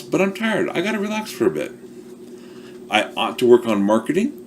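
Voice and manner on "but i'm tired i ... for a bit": much higher and fast, mock-whining "I'm tired"; whiny excuse voice. Shown as a demonstration. Coach mocking the usual excuse, high fast whine. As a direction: whine the excuse like a lazy kid. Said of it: "Most of the time we have it swapped".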